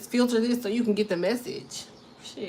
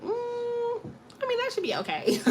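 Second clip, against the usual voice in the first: hesitant concession; 13 semitones higher, 3 dB quieter than usual. Acted. Partner weighing up a smell, hesitant high-pitched concession. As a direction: let it pass in a high, hedging voice. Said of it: "you be like".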